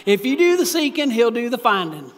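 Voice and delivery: a loud, hammed-up rural Kentucky accent; mock Perry County drawl. Shown as a demonstration. Preacher saying the phrase in a mock Perry County accent. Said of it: "I feel like I should be from Perry County and talk like this".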